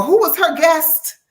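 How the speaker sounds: high, slow curious question; eager viewer voice. Retold or imitated. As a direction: ask like a fan dying to know.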